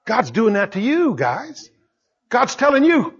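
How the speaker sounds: ordinary delivery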